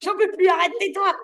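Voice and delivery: high, loud mock outburst; exasperated husband. Teacher mimics a husband sick of his wife's chanting, mock exasperated. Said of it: "Son mari lui a dit".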